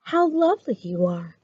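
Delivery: plain reading voice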